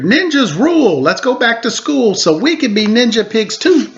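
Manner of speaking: put-on kids whooping for their sister; cheering pigs. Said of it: "They cheered".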